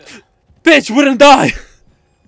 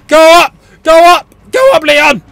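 loud, raised repeated orders; urgent shout; 6 semitones higher, 6 dB louder than usual. Real, not acted. Player ordering Leon up, urgent shout. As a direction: yell commands at the character. Calling orders to the game character.

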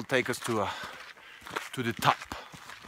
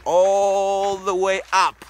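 ALL stretched out long, high and loud; drawn-out complaint; 6 semitones higher, 7 dB louder, much slower than usual. Real, not acted. Grumbling to Oscar about walking up, loud drawn-out complaint. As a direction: drag out "all" in exasperated protest. Teasing complaint to absent brother.

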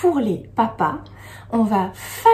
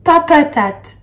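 "papaté" called out higher and louder; cheerful louder "papaté"; loud model answer; 5 semitones higher, 6 dB louder than usual. Shown as a demonstration. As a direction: announce "papaté" triumphantly. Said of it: "Ça donne".

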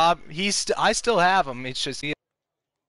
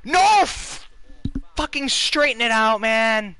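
frustrated yell; 7 semitones higher than usual. Real, not acted. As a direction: yell it out, fed up. Yelling at the batter on screen.

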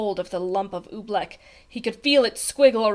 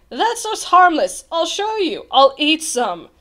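raised, carefree confidence; breezy bravado; 6 semitones higher, 5 dB louder than usual. A narrator's reading. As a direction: reassure with cocky ease. Captain breezily offers to eat it.

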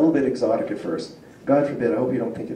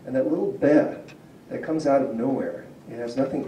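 ordinary talk, nothing marked

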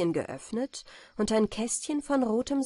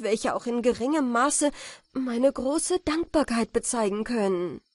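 raised, fervent exclamations; higher, emphatic, overwhelmed delivery; amazed gratitude; 7 semitones higher, 4 dB louder than usual. A narrator's reading. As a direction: pour out thanks in joyful astonishment. Godson amazed, thanks his benefactress.

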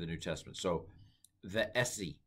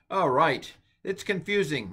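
laughing; 8 semitones higher, 8 dB louder than usual. Real, not acted. Laughs at own confusion.

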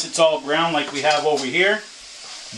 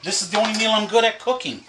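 laughing it off; 6 semitones higher than usual. Real, not acted. Laughing off the argument.

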